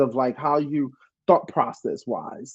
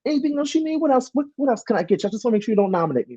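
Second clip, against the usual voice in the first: high, fast fake-sweet suck-up voice; butter him up in a high eager voice; sucking up to the HOH; 11 semitones higher, much faster than usual. Kissing up to D X so he won't nominate her, high and fast. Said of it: "I was like, I was like".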